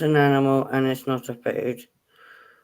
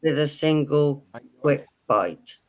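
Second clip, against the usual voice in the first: slow, deliberate riddle reading. Real, not acted. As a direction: read it out slowly, phrase by phrase with pauses. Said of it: "I'll say it slowly as I can".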